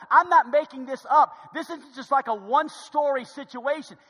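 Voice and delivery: high, loud, driving sermon; impassioned preaching. Real, not acted. Preacher urging church that God uses what they have, impassioned. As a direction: preach it out forcefully, punching key words.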